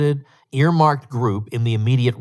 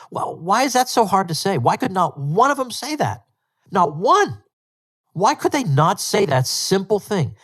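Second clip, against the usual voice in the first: exasperated; 8 semitones higher than usual. Real, not acted. Exasperated rhetorical questions.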